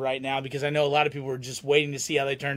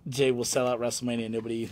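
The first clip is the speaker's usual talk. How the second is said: normal talking voice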